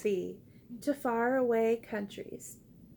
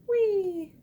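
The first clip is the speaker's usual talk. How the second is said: dolphin's whee; 7 semitones higher, 3 dB louder than usual. Acted. Dolphin jumps over duck.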